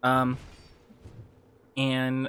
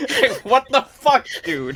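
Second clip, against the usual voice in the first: mock outrage; 12 semitones higher, 7 dB louder, slower than usual. Mock outrage after being hit.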